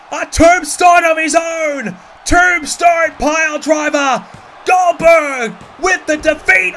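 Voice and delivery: high, loud, frantic ringside call; wrestling commentator. Acted. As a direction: scream the big moves like a live announcer. Live wrestling play-by-play.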